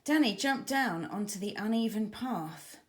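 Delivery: usual speaking voice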